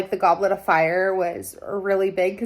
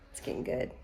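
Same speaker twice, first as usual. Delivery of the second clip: excited whisper; 10 dB quieter, faster than usual. Real, not acted. Whispered excitement over book.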